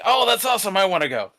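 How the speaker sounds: mock excitement, jumping at the invitation; excited coworker. Coworker acted reacting to furry con.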